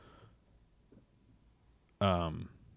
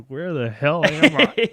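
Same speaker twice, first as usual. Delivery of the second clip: incredulous; 7 semitones higher, 6 dB louder, slower than usual. Retold or imitated. Baffled at groom's speech.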